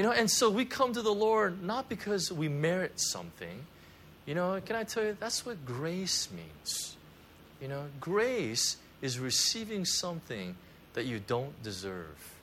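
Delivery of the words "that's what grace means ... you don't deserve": slow, softer weighty delivery; slow emphasis. Acted. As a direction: let each word land slowly. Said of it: "slowed emphasis on grace".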